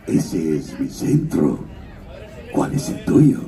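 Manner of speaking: low, slow Santa Claus with a Russian accent; a deep jolly North with Russian accent. Shown as a demonstration. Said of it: "tiene acento ruso".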